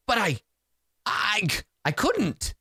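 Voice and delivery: high, halting mock protest; protesting sorcerer. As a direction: stammer it out and stretch the ending.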